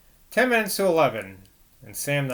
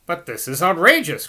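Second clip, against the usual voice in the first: louder, indignant cry; cried out; 4 semitones higher, 5 dB louder than usual. Acted. Priest crying out at the nurse's news, loud and indignant. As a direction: protest loudly, shocked. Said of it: "cried Mister Decker".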